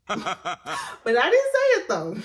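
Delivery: laughing through the words, slowly. Real, not acted. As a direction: chuckle it out, amused at herself. Laughs at her own restraint.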